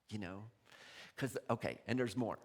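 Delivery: usual speaking voice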